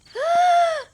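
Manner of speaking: high loud startled gasp; sharp frightened gasp. Real, not acted. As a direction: gasp at the jump scare. Sudden scare.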